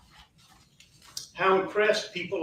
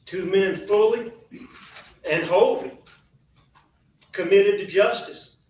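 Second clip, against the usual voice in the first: louder, pressing each word; rising, forceful defence of his family; emphatic, louder; 2 semitones higher, 7 dB louder than usual. Real, not acted. Defending father and grandfather.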